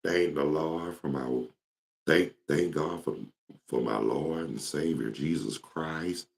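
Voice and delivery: laid-back, slow and soft. Retold or imitated. Showing how his late friend talked, laid back and soft. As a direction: take it easy, unhurried and quiet. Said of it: "he talk laid back".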